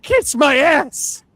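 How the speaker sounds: drawn-out, high, loud "Kiss my ass"; hammed-up dying villain's sneer; defiant villain. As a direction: hurl it slowly like a cornered villain. Retold villain's defiant last words.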